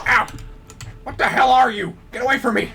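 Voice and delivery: panicked yelling. Real, not acted. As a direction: yell in real panic. Attacked by a creature.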